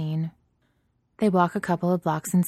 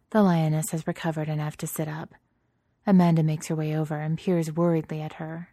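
ordinary delivery, nothing marked

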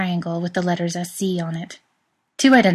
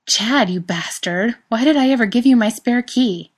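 louder and higher, playful fake fury at a friend; mock outraged yell; 5 semitones higher, 5 dB louder than usual. Jared storming in on his best friend, playful mock outraged yell. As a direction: bellow it like a betrayed buddy, half joking.

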